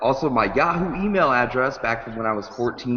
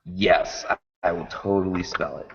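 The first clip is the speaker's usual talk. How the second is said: normal talking voice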